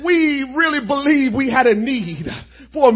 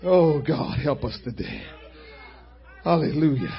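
much softer, lower murmured prayer; 6 semitones lower, 8 dB quieter than usual. Acted. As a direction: pray quietly under the music. Soft prayer over music.

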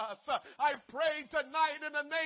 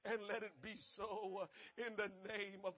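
much softer, lower, fading prayer; hushed prayer; 6 semitones lower, 9 dB quieter than usual. Real, not acted. Pastor closing prayer, softly fading. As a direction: let it sink to a murmur before God.

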